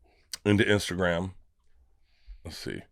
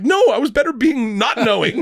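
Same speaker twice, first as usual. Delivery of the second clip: mock protest; 14 semitones higher, 6 dB louder than usual. Retold plea to Tom.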